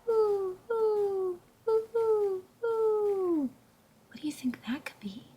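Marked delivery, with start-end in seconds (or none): 0.1-3.5 higher owl hoots, far and hollow; the owl answering Pa from the trees, high hollow hoots. Acted. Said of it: "an echo came threading its way".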